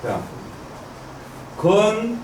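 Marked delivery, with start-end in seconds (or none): 1.6-2.2 high, measured recitation; syllable by syllable. Shown as a demonstration. Teacher reading upward through the hexagram, syllable by syllable. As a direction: recite it one held syllable at a time. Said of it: "그냥 이렇게 올라가면 되죠?".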